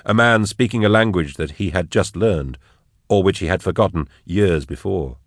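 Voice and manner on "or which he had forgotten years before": ordinary delivery, nothing marked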